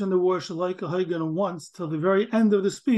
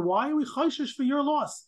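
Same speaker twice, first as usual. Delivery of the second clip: higher, protesting innocence; indignant defendant; 7 semitones higher than usual. Retold or imitated. Study partner voices the owner protesting to the court, indignant. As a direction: plead that you did nothing wrong.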